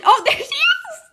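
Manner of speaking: loud, high laughing squeal; real excited squeal; excited exclamation. Real, not acted. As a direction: burst out laughing in delighted surprise. Player delighted jammer reappears.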